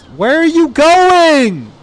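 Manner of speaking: high, slow, drawn-out yell; mock exasperated yell. Player hollers at his wandering troops, high and slow. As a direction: holler it after troops walking away.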